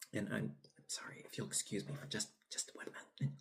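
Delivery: quick hushed whisper. Real, not acted. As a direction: whisper it fast and apologetic. Excuses himself mid-video to eat.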